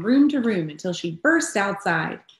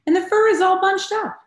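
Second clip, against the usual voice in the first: much higher, louder fussy mother bear; indignant Mama Bear; 13 semitones higher, 6 dB louder than usual. A narrator's reading. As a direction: fuss over the bunched-up fur. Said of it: "she huffed".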